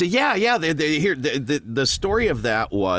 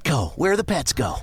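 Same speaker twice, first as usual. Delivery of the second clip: higher, sung-along announcer read of the slogan; Petco jingle voice; 6 semitones higher than usual. Shown as a demonstration. Voice actor showing the host his old Petco tagline, announcer style. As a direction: sell it like the store's radio spot. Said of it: "I was the voice of Petco pet stores".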